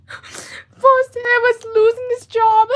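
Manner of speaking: slow, high, loud tearful wail; real crying. Real, not acted. Artist telling viewers about losing this job, crying. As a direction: drag the words out through sobs. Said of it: "what really gets me crying right now".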